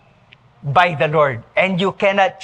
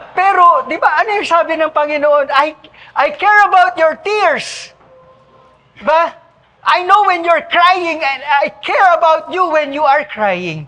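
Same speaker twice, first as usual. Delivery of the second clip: higher and louder, pressing the point home to the congregation; fervent preaching; 9 semitones higher, 4 dB louder than usual. Acted. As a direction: preach it with rising fervor. Preaching at full force.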